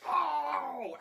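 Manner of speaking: sudden high yelp of pain; shocked yelp. Retold or imitated. Boy retelling a lamp shock, high pained yelp. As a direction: yelp like the arm just got zapped.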